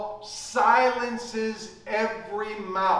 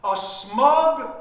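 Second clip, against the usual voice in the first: ordinary talk, nothing marked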